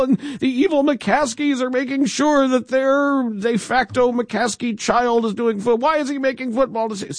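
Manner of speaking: high, frantic hysterical fan; mock panicked fan. Mocking panicked Bears fans about Ted Phillips, high and frantic. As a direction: shriek like a paranoid fan. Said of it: "reflexive terror on the part of people".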